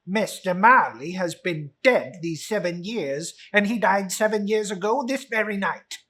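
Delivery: put-on loud, curt old miser; a hint of a grumpy miser; Scrooge. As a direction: answer coldly as Scrooge. Scrooge voiced in story.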